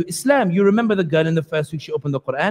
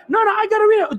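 much higher, urgent I gotta read it; frantic insistence; 12 semitones higher, faster than usual. Retold or imitated. Newcomer in the skit insisting on reading, much higher and frantic. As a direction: insist like you can't stop yourself. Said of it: "And I'll try to imitate it here".

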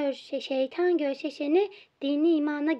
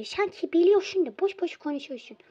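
ordinary talk

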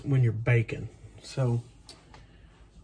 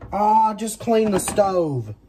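exasperated groan; 7 semitones higher, 7 dB louder, much slower than usual. Real, not acted. Spills on stove while cooking.

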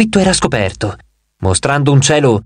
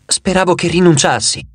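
ordinary delivery

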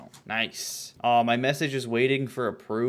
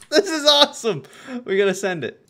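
loud, high, thrilled; high-pitched excitement settling slightly; delighted outburst; 11 semitones higher, 9 dB louder than usual. Real, not acted. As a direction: blurt it out still giddy from laughing. Delighted after laughing fit at video.

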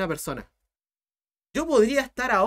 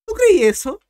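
incredulous; 10 semitones higher, 10 dB louder than usual. Real, not acted. Incredulous challenge to student.

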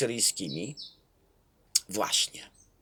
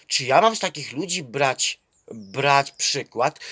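usual speaking voice

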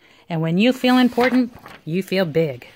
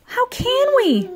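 very high, drawn-out mock-scared wail; fearful; slower than usual. A narrator's reading. Frightened children ask Will how, fearful. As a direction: wail it slowly, pitched way up. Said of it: "quivering with fear".

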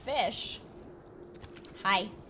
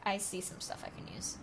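soft whisper, lower; hushed whisper; 3 semitones lower, 8 dB quieter than usual. Real, not acted. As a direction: breathe it out in a whisper.

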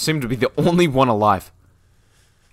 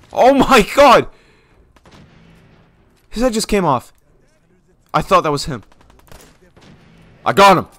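high, loud astonished yells; shocked shouting; 8 semitones higher, 9 dB louder than usual. Real, not acted. Player after a gory kill, high loud shock.

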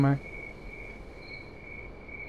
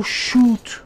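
ordinary talk, nothing marked

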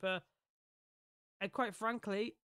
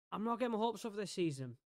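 quick, higher-pitched delivery; quick statement; 4 semitones higher, faster than usual. Real, not acted. Player to viewers about the season ahead, quick and higher. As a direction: say it briskly, pitched up. First take of repeated resignation.

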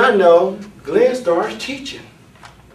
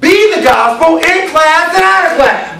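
high-pitched pulpit shout; forceful preaching; 11 semitones higher, 4 dB louder, slower than usual. Real, not acted. Preacher applies the verse to all in the room, forceful preaching. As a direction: pitch it high and hold it there.

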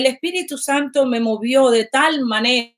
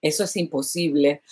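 low, soft "Eso es imposible"; subdued discouraging quote; quiet doubter's voice; 8 semitones lower, 5 dB quieter than usual. Retold or imitated. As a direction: say it like a voice crushing hope. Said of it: "por el otro lado dice".

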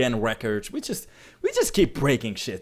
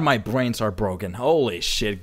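normal talking voice

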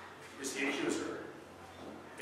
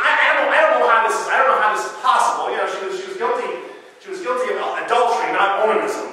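louder, faster disbelief; incredulous; 5 semitones higher, 9 dB louder, faster than usual. Real, not acted. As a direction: rush it out in disbelief. Incredulous aside about the story.

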